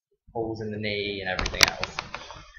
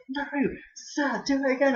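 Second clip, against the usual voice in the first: much higher, louder mock pleading kid; begging pupil; 4 dB louder than usual. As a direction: imitate a kid begging for an encore. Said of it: "I'm like".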